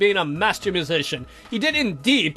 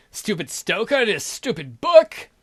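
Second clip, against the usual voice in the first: ordinary talk, nothing marked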